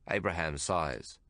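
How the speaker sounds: usual speaking voice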